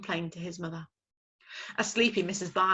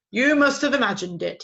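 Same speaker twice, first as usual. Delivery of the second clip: grumpy father owl; 5 semitones higher, 10 dB louder than usual. Acted. Father owl grumbling at his chick. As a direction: send the chick away.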